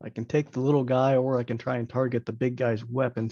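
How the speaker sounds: ordinary talk, nothing marked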